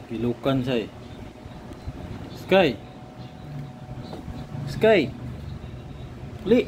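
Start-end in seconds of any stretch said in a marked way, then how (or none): 2.4-6.6 high-pitched calls to get a pet's attention; high, brief attention-getting call. Real, not acted. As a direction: call the pet by name, higher and short. Calling out to pet Sky.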